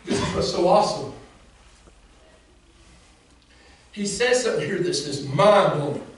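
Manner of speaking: high, loud preaching shout; full-force preaching. Acted. Preacher shouting to the congregation at full force. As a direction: preach it out to the crowd.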